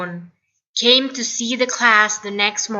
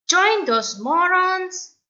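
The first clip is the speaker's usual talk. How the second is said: higher, slow outraged voice; indignant outcry; 9 semitones higher than usual. Acted. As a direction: cry out in disbelief. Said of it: "cried Bolan".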